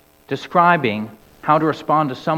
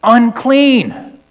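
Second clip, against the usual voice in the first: high, loud warning call; 9 semitones higher, 7 dB louder, much slower than usual. Acted. Leper calling out to passersby, high and loud. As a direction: cry it out as a leper warns others. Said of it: "and call out".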